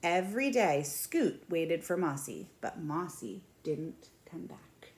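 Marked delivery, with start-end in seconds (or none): 2.6-4.9 breathy hush for the lonely ending; whispered sadly. A narrator's reading. Reading to children, whispering that Mossy never came back. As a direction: drop to a whisper, slow and sad. Sad narration line, whispered.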